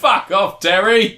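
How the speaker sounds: high, loud comic heckle; mock shouted dismissal. Heckler answers the boasting leader, mock shouted dismissal. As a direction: yell it as a jokey brush-off.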